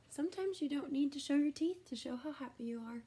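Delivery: gentle whispered aside. Real, not acted. Soft aside to children.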